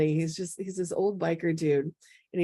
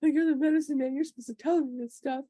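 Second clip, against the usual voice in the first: tearful protest; 9 semitones higher, faster than usual. Retold or imitated. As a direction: voice jumps higher, near tears, imitating herself. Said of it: "I just start bursting into tears".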